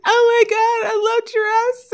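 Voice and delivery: high-pitched mock weepy gush; crying with joy. Imitating a girl who met the giraffes, tearful gushing. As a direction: imitate an overcome fan near tears. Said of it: "she was crying".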